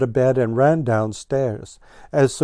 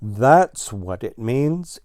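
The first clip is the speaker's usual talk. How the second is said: slow boast; 3 semitones higher, slower than usual. Acted. Blue Jay boasting slowly.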